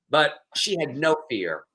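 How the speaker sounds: normal talking voice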